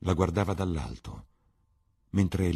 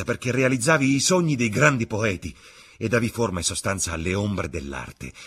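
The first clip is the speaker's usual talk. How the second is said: loud, high outburst of accusation; shouted; 6 semitones higher, 8 dB louder than usual. A narrator's reading. Dorian at the door accusing Sibyl, shouting. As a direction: hurl the accusation out at full voice. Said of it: "gridò".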